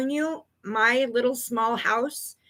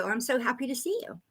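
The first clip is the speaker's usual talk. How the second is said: ordinary talk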